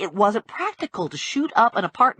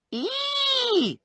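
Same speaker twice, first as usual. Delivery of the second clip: very high, held squeal; shriek; 4 dB quieter than usual. A narrator's reading. Little man reacting to the handcuff, high-pitched shriek. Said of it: "still shrieking".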